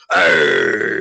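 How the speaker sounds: low, gravelly pirate growl. Retold or imitated. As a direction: growl it like an old sea dog. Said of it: "One is very much more gravelly".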